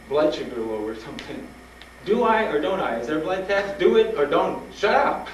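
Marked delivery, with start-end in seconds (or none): 2.0-5.3 high, loud mock exasperation; hammed-up rapid-fire challenge; impatient demand. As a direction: cut the doctor off, fed up. Acted impatient demand to the doctor.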